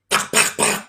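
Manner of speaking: voiceless tak tak tak clicks for a slap; slapping sounds. Retold or imitated. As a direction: tick out the slaps with no voice. Imitating a slap on the face.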